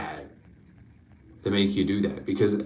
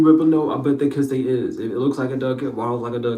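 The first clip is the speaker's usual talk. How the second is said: ordinary delivery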